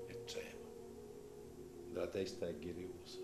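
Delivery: plain reading voice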